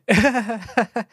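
awkward embarrassed chuckle; nervous laugh. Real, not acted. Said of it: "That was the most nervous laugh I've ever heard".

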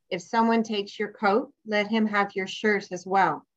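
normal talking voice

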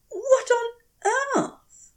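high, startled "what on earth"; bewildered cry on finding mud; astonished exclamation. Acted. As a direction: blurt it out in shock. Finds muddy leaf on feet.

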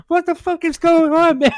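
high, loud mock crowd yell; hammed-up furious stadium yell; yelling at the screen. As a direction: shout at the screen like an angry fan. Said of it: "is yelling at the screen like".

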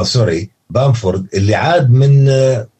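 ordinary talk, nothing marked

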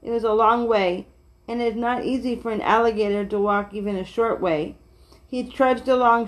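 ordinary delivery

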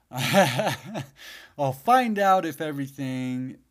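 slow, laughing nervously. Real, not acted. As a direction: chuckle through it. Laughing about going live.

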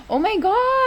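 drawn-out, high-pitched "oh my God"; gasp, then cry out in disbelief; real shock, slow and high. Real, not acted. Gasps at what was read.

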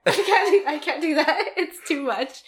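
loud, high protest easing down after laughter; laughing protest. Real, not acted. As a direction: plead off while still giggling. Still refusing amid laughter.

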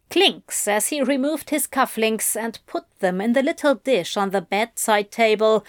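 ordinary delivery

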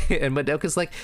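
ordinary talk, nothing marked

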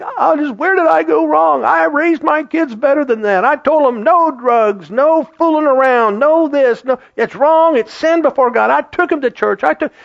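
high, pleading mock lament of a hurt parent. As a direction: play the bewildered parent wailing "where did I go wrong". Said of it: "we would sit back and think".

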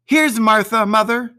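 a daughter stepping in to surprise her mother; girl announcing herself. A narrator's reading. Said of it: "said a girl".

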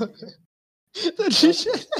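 high, slow, laughing through it. Real, not acted. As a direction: squeal the words through laughter. Laughs at wrong flag.

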